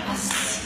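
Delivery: a gentle breathy whistle sound; soft whistle. Shown as a demonstration. Preacher imitating the still small voice, soft breathy whistle. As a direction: imitate a soft whisper of wind. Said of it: "vino un silbido suave".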